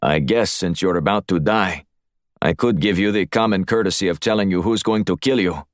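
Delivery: put-on coolly menacing Russian-accented host; threatening Russian accent. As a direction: deliver the threat as a Russian heavy.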